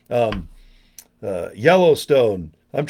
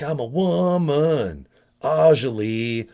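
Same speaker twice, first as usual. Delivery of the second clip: slow, breathy come-hither ad line; slow seductive commercial tagline; sultry ad voice; much slower than usual. Retold or imitated. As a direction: purr it like a seventies shampoo ad. Quoting the sexy commercial woman.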